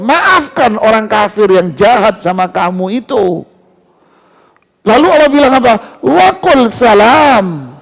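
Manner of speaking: high, loud emphatic preaching; fiery preaching. Acted. Preacher urging the congregation to forgive unbelievers, fiery and loud. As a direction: drive the point home to the congregation.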